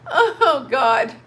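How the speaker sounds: gasping through laughter; laughing hard. Real, not acted. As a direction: laugh so hard it almost turns to tears. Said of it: "I can't stop".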